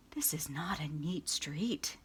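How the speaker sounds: a slow, breathy whisper; slow hushed stranger. Acted. Stranger mutters disapprovingly to himself in a slow whisper. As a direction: murmur it under the breath, slowly. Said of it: "the stranger would say".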